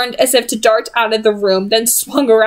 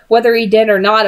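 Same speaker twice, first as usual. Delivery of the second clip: ordinary delivery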